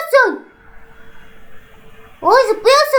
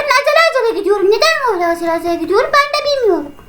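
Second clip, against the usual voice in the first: rushed; 2 semitones higher, much faster than usual. Real, not acted. Rushing, fast talk.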